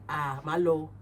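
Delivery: normal talking voice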